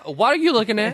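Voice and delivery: high, indignant question; indignant quote. Retold or imitated. Vivian to the waiter, high and indignant. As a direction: snap at the staring waiter. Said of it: "She just puts her hand".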